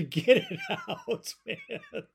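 laughing through the words. Real, not acted. Host laughing about the caller's rant. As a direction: let a laugh break in at the end.

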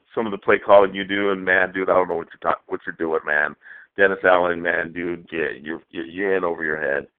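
ordinary delivery

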